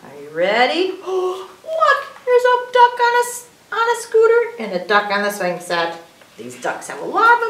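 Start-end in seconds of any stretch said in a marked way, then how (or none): 0.9-4.4 high, loud wonder after a gasp; excited discovery. Pretend. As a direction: gasp and point it out to the kids. Gasps at new picture.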